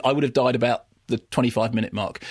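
ordinary talk, nothing marked

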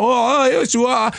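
high, strained Cockney geezer voice; London geezer voice. Retold or imitated. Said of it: "they all talk like this".

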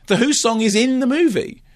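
high-pitched, indignant insistence; exasperated protest. Real, not acted. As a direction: protest in a squeal of disbelief. Indignant rebuttal to the co-host.

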